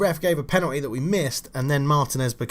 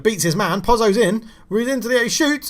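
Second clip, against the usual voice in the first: rising, louder play-by-play as the shot comes; excited commentary; 10 semitones higher, 5 dB louder than usual. Real, not acted. Commentating his own striker's chance, building excitement.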